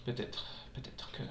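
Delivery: hushed whisper. Acted. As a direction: breathe the words softly. Whispering game dialogue aloud.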